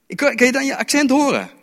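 high-pitched acted accusation; incredulous crowd member, acted high; challenging bystanders. Voices bystanders challenging Peter's accent.

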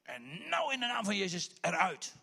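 loud, slow, forceful command; commanding rebuke. Retold or imitated. Paul turning to the slave girl and casting the spirit out. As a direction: cast the spirit out with authority.

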